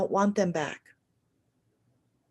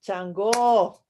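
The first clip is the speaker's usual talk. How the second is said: ordinary talk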